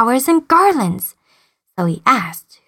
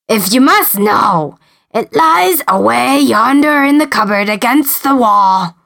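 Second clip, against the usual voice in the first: giant voice. Acted. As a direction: rumble it like a giant.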